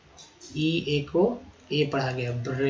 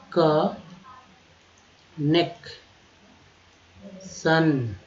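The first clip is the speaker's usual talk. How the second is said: extremely slow drawn-out word; slow demo; much slower than usual. Shown as a demonstration. Teacher modelling the word, hugely stretched. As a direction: hold each sound long. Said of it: "teacher stretches word".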